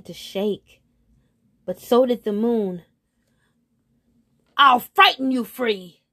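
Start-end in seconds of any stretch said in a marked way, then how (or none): 4.5-6.0 loud, high eagle cry; frightening threat. A narrator's reading. Eagle to the trapped moon, loudly threatening. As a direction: call it out forcefully to scare.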